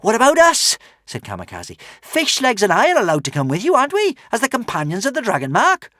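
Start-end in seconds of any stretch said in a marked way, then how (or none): 0.0-0.8 put-on high, bold girl asking; eager girl's voice. Kamikaze asks. 2.0-5.9 put-on high, bold girl asking; eager girl's voice. Kamikaze continues.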